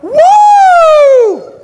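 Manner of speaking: very high, loud whoop; hammed-up woo; mock cheer. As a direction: cheer like at a rally. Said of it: "I was like".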